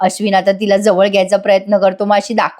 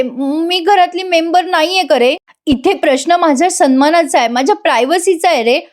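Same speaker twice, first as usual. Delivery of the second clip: acted whine of a wronged wife; mock tearful plea; 6 semitones higher than usual. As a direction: plead like a wife putting on tears. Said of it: "ती आता रडायला लागते".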